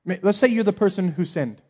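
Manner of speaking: ordinary talk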